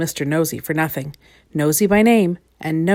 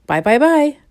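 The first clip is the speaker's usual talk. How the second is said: high, loud singsong farewell call; calling after; 8 semitones higher, 6 dB louder than usual. A narrator's reading. Twins see their visitor off, calling after him. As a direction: call it after someone who is leaving. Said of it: "the twins called after him".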